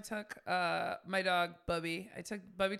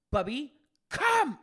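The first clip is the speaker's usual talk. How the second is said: firm dog command; 5 semitones higher, 6 dB louder, slower than usual. Retold or imitated. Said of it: "And I said".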